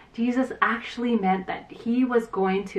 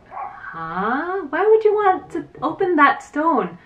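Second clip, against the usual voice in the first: a startled why, pitched up; surprised question; 6 semitones higher, slower than usual. Acted. Martha questions opening the tomb.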